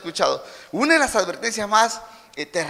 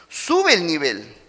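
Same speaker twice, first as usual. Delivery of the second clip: ordinary talk, nothing marked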